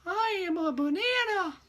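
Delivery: squeaky high cartoon line; cartoon banana voice. Teacher imitating a rejected cartoon, squeaky high. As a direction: play a silly cartoon banana. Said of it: "I'll just do it one more time".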